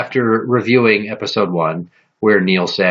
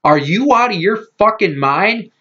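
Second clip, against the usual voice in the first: outraged yell; 5 semitones higher than usual. Retold or imitated. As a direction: yell it at her, high and indignant. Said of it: "I sit there and I'm like".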